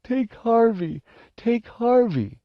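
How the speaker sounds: very high wailing plea; desperate tearful offer; sobbed. Acted. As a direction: squeal it through sobs. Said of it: "he sobbed".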